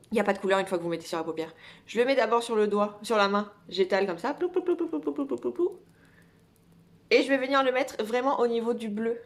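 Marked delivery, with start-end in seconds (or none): 4.3-5.8 playful plou sounds. Pretend. Dabbing glitter, playful sound effect.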